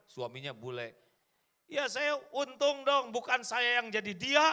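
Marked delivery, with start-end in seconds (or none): 1.7-4.5 cheerful wife. Acted. Patient wife voiced in story.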